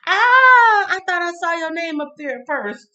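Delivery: loud high "Ah" easing down; surprised exclamation. Real, not acted. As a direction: cry out at your own mix-up, high-pitched. Exclaims over her mistake.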